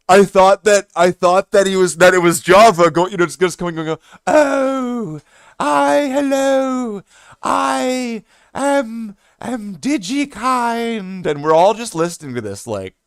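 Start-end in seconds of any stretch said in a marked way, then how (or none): 4.3-11.3 high, slow, drawn-out put-on greeting; odd stranger voice. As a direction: stretch every word, high and strange.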